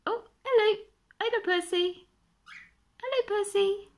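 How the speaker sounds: high, loud sing-song greeting; talking to a pet bird. Real, not acted. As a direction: coo hello up at the bird. Greeting Percy the cockatiel.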